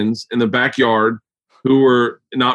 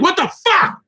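incredulous squeal. Real, not acted. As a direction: voice jumps high in disbelief. Incredulous outburst, very high pitch.

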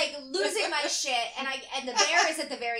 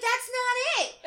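high, louder comic scolding; mock screaming; 10 semitones higher, 5 dB louder than usual. Woman telling a raccoon off, high mock scream. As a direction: yell a correction at an animal. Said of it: "clapping loudly at him and screaming".

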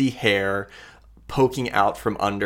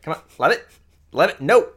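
higher-pitched coaxing turning to scolding; calling a pet away; 8 semitones higher, much faster than usual. Acted. Woman drags her creature back to camp, scolding. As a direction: coax it along, then scold.

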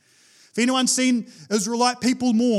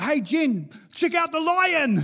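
excited pointing; 7 semitones higher than usual. Pretend. Harvey on safari pointing.

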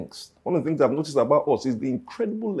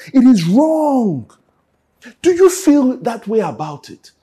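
loud, higher preaching push; full-force preaching; 7 semitones higher, 6 dB louder than usual. Acted. Preacher urging the congregation, loud and higher. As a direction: drive the message home, loud.

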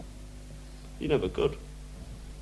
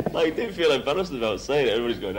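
ordinary talk, nothing marked

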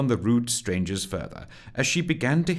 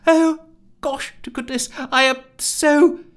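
high, loud fretful voice; squeaky, mortified character voice; flustered apology; 7 dB louder than usual. Acted. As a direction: gush an apology in a high panic.

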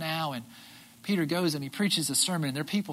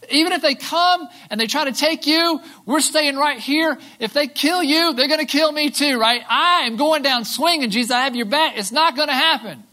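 Peter's bold promise; 9 semitones higher, 10 dB louder than usual. Retold or imitated. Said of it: "Peter's like".

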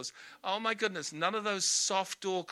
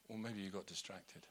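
ordinary talk, nothing marked

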